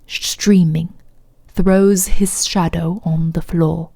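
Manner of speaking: low, solemn posh British recital, slowing to the end; fake posh British accent. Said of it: "my fake ass posh British accent".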